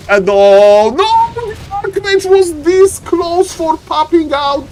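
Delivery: long, drawn-out high cry of disbelief; dismayed excitement. Real, not acted. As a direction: wail the near miss like a frantic caster. Caster reacts to a near escape.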